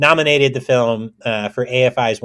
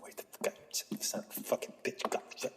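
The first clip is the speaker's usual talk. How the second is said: very quiet, voiceless grumbling; frustrated whisper; whispered cursing; 11 semitones higher, 11 dB quieter, faster than usual. Acted. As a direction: swear furiously under your breath. Cursing under breath.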